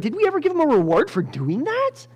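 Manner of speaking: high-pitched acted astonishment; startled realization. King asking servants about Mordecai's reward, mock startled. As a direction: ask it in high, comic disbelief.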